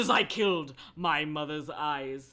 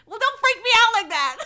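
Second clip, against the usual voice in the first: high, loud, fast outburst; laughing protest; 11 dB louder, faster than usual. Real, not acted. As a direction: yelp it while cracking up. Mock protest to chat, laughing.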